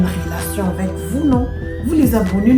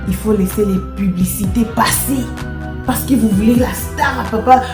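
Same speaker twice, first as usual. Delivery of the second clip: ordinary delivery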